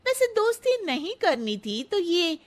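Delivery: mock her makeover; snide auntie. Auntie retorts.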